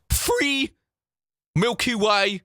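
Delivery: high mocking English accent, TH as F; mock the English accent with dropped T; ugly English accent. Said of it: "It is the ugliest accent ever".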